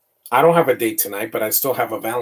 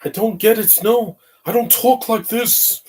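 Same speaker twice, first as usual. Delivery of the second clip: much higher, slower put-on voice imitating an actor; Matt Smith voice; 11 semitones higher than usual. Mocking a movie character who talks like Matt Smith, high-pitched imitation. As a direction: mimic a quirky Doctor Who delivery, pitched way up and drawn out. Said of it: "That's how Matt Smith talks".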